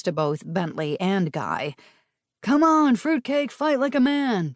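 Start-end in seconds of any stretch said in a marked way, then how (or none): 2.4-4.5 high, loud jeering heckle; a local heckles the brawlers in the snow; heckling call. A narrator's reading. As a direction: yell it from the crowd. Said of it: "someone called".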